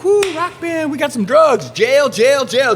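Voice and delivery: high, loud sing-song taunt; gloating cop. Retold or imitated. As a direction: crow jail jail like a cop who caught a rock band. Young cop gloating at the band.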